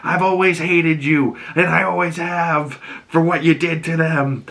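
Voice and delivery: mother telling Oz she always hated him; bitter mother. Retold or imitated. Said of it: "she goes".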